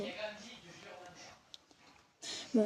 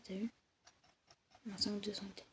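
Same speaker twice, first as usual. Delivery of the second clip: muttered; 14 dB quieter than usual. Real, not acted. Repeats to herself quietly.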